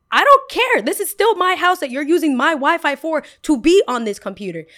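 high, loud, furious; yelling at a boy on her Wi-Fi; outraged yell. Retold or imitated. High, loud yell.

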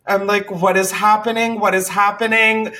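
high-pitched mild panic; a touch of rising alarm; retold panic. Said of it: "I'm, like, panicking".

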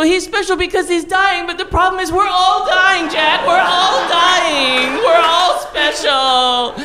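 hammed-up breakdown. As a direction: build into an overwrought monologue, stretching the last lines. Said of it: "I just had a full-on breakdown".

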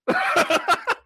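high-pitched burst of laughter. Real, not acted. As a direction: laugh high in delighted disbelief. Laughs after mock disbelief.

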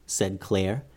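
ordinary delivery, nothing marked

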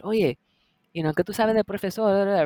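casual Spanish aside to a classmate; Spanish chatter. Retold or imitated. Mother asks a classmate in Spanish, casual. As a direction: lean over and ask in Spanish. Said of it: "says in Spanish".

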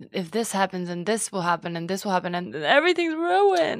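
mock catastrophizing, pitch leaping at the end; spiralling worry. Host voicing one little thing going wrong, mock spiralling panic. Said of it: "it spirals in my mind".

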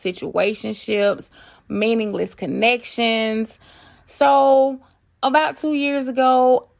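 loud, punchy trailer voiceover; dramatic intro narration. Acted. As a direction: sell it like a show opener.

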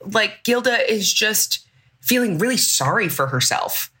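ordinary talk, nothing marked

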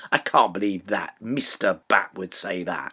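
ordinary delivery

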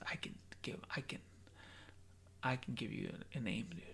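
hushed, halting near-whisper; soft, low murmur with pauses; confidential offer. Acted. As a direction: offer it quietly like a secret. Acting Elon's secret offer.

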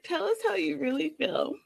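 high, amused teasing; playful retort. Retold or imitated. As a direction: tease him lightly, grinning. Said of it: "I was like".

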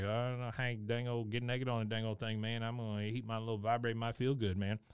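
soft, run-on mumbling impression; imitated rambling mumbler; Boomhauer. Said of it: "He's like".

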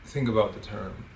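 ordinary talk

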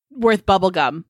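usual speaking voice

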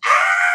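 very high scream; imitated excited scream. Mom screaming in her daughter's ear at pregnancy news, high scream. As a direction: let out mom's piercing squeal. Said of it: "started screaming in my ear".

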